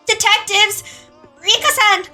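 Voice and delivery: high, drawn-out desperate calls; calling for help. Acted. Mion calling detectives and Rika, high desperate shout. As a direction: shout for rescue. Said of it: "raising her voice for no reason".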